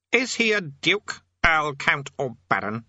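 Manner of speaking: high opening, stepping down the list; imperious king. A narrator's reading. Demon King questioning his underling about Quez-Over-Coatl, imperious. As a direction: demand it slowly.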